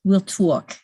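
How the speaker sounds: put-on thick New York accent. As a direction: say it like a New Yorker. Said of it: "How do you like my New York accent".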